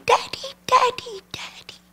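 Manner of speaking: put-on high, light child voice fading to a whisper; little girl calling. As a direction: call for daddy like an eager little girl, trailing off. Little girl running to dad.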